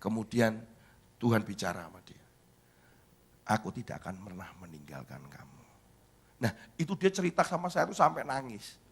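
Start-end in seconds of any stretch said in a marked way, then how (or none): 3.5-5.6 low, hushed and reassuring; quiet, deep-pitched reassurance; tender voice of God. Retold or imitated. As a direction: speak softly and low, as a gentle promise. God's words to the child.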